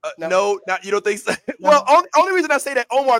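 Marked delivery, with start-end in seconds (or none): 1.6-3.2 high, loud and fast, laughing into it; rushing to argue back; excited protest. Real, not acted. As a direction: jump in eagerly to defend the point.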